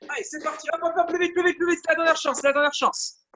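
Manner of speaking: high-pitched, rapid-fire final push; urgent coaching. Real, not acted. Coach pushing the class through a last chance, urgent. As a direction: chant the cues quickly, driving them on.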